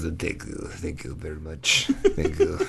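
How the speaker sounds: put-on soft Russian-accented thanks; old Russian lady. Old Russian comic thanking polite audience, soft accent. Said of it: "this old Russian lady".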